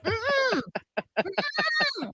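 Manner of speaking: high-pitched "mm-mm"; imitated refusal hum. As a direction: squeal a mock no through closed lips. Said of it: "And they're like".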